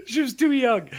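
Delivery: high laughing mock mourning. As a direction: laugh through a repeated line. Laughing repeat, high and far.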